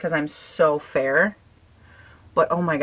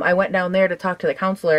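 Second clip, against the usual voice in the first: usual speaking voice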